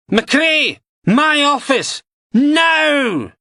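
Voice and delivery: huge high bellow across the canteen. A narrator's reading. DI bellowing for McRae across the canteen, furious. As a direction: roar the summons. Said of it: "then bellowed".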